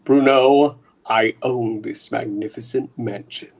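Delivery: low menacing mastermind voice; sinister villain. Acted. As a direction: gloat like a criminal boss. Villain boss speaks.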